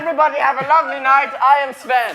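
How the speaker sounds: fast, loud farewell shout; loud sign-off. Acted. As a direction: announce it like a showman. Sven character signing off loudly.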